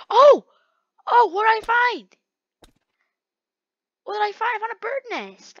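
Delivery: high, squealy put-on wonder; playful high-pitched surprise, softening at the end; mock delighted discovery. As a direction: coo over it in a high voice. Putting on a silly delighted voice.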